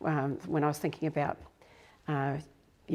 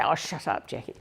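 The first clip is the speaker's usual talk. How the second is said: lightly voiced old mum; 4 semitones lower, 3 dB quieter than usual. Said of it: "And she'd say".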